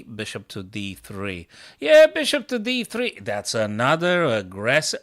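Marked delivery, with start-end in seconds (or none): 1.8-3.2 high, loud, eager call; excited announcement. Real, not acted. Streamer hyping his bishop move, high and loud.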